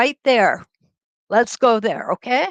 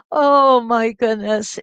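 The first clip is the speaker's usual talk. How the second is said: ordinary talk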